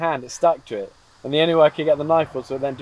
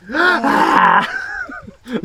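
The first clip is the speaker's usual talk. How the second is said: gasp reflex demo. Shown as a demonstration. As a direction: show a shocked gasp. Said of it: "The first reaction is this".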